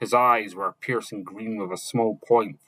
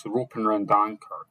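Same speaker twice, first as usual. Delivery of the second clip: usual speaking voice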